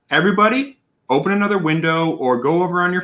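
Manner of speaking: calling out. Real, not acted. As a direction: summon the whole chat at once. Calling out to the chat.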